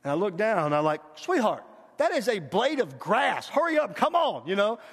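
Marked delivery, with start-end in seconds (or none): 1.2-4.5 higher, exasperated words to a dawdling daughter; acted impatient dad. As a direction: hurry her along, playing the impatient father. Acts impatient dad hurrying daughter.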